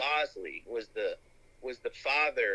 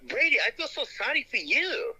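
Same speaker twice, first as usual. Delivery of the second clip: high, put-on Chinese accent voice, far higher pitch; mock Chinese accent, pitched way up; Chinese guy voice; 12 semitones higher than usual. Teasing a friend in a put-on Chinese accent. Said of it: "a Chinese guy voice".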